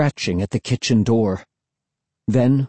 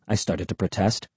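ordinary delivery

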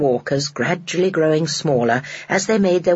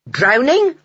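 incredulous; 8 semitones higher, 4 dB louder than usual. A narrator's reading. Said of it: "said the man".

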